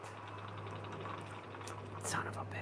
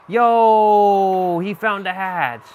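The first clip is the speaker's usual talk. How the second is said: excited shout; 6 semitones higher, 11 dB louder, much slower than usual. Real, not acted. Killer finds the hatch.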